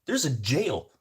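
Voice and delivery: high, loud laughing confession; driver's outburst. Retold or imitated. Driver admitting he feared the passenger, high and loud. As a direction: blurt it back, just as scared. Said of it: "And the guy's like".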